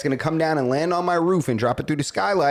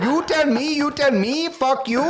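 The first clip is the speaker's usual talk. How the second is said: high-pitched mock indignation; hammed-up outraged foreign caller; imitated offended caller; 9 semitones higher than usual. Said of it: "He said".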